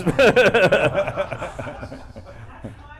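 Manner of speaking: long high peal of laughter; high, drawn-out laugh. Real, not acted. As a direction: burst out laughing and let it run down. Cracks up at own remark.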